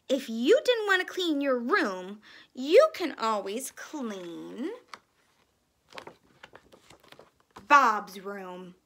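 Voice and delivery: loud, slowing scolding mother; stern mother. Acted. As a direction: lay down the rule firmly.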